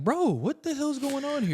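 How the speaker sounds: high, slow, bewildered; mock disbelief, slow and high; exasperated. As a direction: drag it out in disbelief. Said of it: "It's like".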